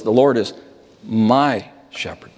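pause, then slow, drawn-out "my" before "shepherd"; stress on MY. Shown as a demonstration. Preacher naming the focus of the verse, stress on "my". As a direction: set "my" apart after a beat. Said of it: "Here the focus is".

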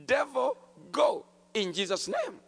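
loud, high rebuke of the devil; commanding shout. Retold or imitated. New believer rebukes the devil in prayer, loud command. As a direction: order the devil out at full voice.

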